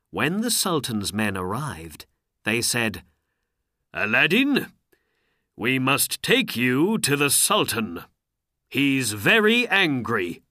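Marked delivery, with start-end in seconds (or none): 3.9-10.4 louder, a touch higher official order; a hint of a stern guardsman; lightly voiced soldier. A narrator's reading. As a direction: summon him firmly as the Sultan's guard. Soldiers come to seize him.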